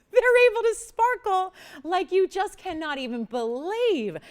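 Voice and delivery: high, slow, thrilled gush; slow, high-pitched gush of wonder; gushing excitement. Real, not acted. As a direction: marvel at it like you can't believe your eyes. Host gushing over the sparkle.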